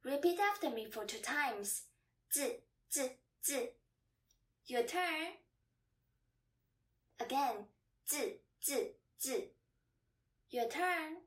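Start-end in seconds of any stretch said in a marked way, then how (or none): 2.3-3.7 "Zi, zi, zi", each short and separated; short fifth tone. Shown as a demonstration. Teacher modelling the third word to repeat, short and fast. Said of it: "third Chinese word with the fifth tone". 8.1-9.5 "Zi, zi, zi", each short and separated; quick, cut-short "zi" on each beat; short fifth tone. Shown as a demonstration. Teacher repeating the third word for learners, short and fast. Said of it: "third Chinese word with the fifth tone".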